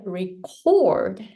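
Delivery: "record" as verb, weak re, strong long CORD; stress on CORD. Shown as a demonstration. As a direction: lean on the second syllable of "record". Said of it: "cord is stronger than re".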